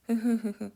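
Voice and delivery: put-on a drunk man's self-satisfied giggle; drunk chuckle. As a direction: chuckle smugly like a tipsy father.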